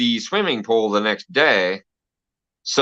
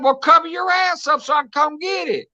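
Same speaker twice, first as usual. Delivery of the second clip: put-on high, exasperated boy voice; imitating himself as a kid; 13 semitones higher than usual. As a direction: answer back like a fed-up kid. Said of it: "I'm like".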